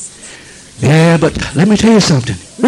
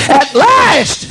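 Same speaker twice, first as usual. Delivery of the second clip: "at last" lifted high, bright and joyful; exultant at last; 9 semitones higher than usual. Acted. Preacher voicing Jesus overjoyed, raised high. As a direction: sing out "at last" with delight. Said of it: "repeated at last takes".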